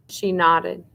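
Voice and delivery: usual speaking voice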